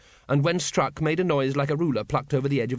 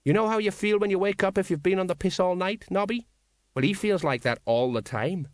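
explaining Colon; 7 semitones higher than usual. A narrator's reading. Colon, figure in narrated story.

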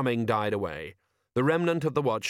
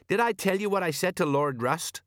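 nervous; 8 semitones higher than usual. Acted. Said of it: "said Sergeant Colan nervously".

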